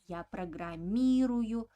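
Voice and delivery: long stressed И in программирую. Shown as a demonstration. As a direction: land firmly on И in программирую. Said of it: "So, accent falls on E".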